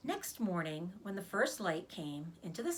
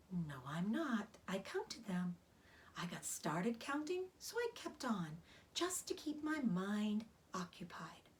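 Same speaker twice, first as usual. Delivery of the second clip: Charlotte, weak spider; 4 dB quieter than usual. Acted.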